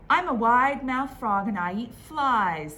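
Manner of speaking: big boastful frog; proud frog calls out his line; loud frog voice. Acted.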